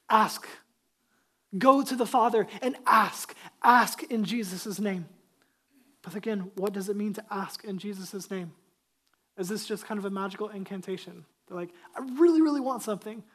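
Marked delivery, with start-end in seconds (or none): 0.1-5.1 loud, punched commands; urgent exhortation. Real, not acted. Pastor urging the church to go and ask, loud exhortation. 11.9-13.2 mock pleading. Acting out someone wanting a magic prayer.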